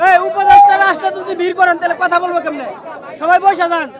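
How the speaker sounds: loud, real crowd-control call. Real, not acted. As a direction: call out loudly to get the crowd seated. Calling out to seat crowd. (ভিড়কে বসাতে জোরে হাঁক)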